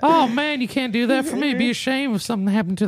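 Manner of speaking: high, amused old man impression; playful elderly guilt trip; guilt-tripping old man. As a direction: lay on mock disappointment in a high old voice. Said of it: "He goes".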